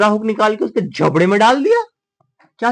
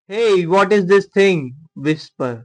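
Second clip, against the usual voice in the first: ordinary talk, nothing marked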